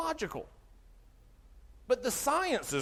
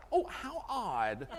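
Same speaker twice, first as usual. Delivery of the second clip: laughing mock surprise; much slower than usual. Mocking reply, laughing.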